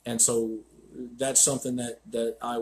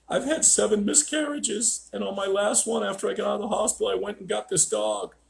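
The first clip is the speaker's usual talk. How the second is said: high, strained retelling of a bawling confession; imitating tearful client; 7 semitones higher than usual. Client confessing to the trainer after bawling, imitated high tearful voice. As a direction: voice her higher, as if through tears. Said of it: "and just started bawling".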